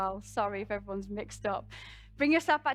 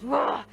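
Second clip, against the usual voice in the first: loud, open roar; sudden burst of roaring energy; Kali roar; 3 semitones higher, 8 dB louder than usual. Acted. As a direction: let out a short fierce roar. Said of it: "that Kali energy that goes".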